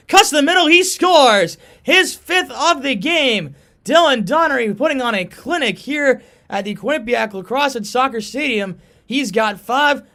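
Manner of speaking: loud, high goal call; long goal yell. Real, not acted. Goal call by play-by-play announcer.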